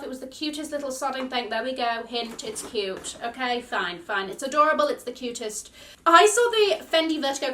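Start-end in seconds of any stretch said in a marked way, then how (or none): none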